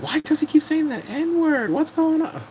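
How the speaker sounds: high-pitched, slow mock bewilderment; scandalized onlooker. Onlookers gossiping about strangers, high and scandalized. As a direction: act out baffled gossip, voice way up.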